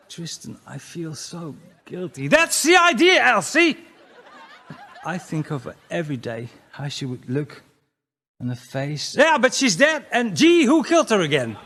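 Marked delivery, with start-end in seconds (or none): 0.1-2.3 soft ex's voice. Acted. Ex's reply on phone. 2.3-3.8 gloating shout. Acted. 9.2-11.6 taunting. Acted.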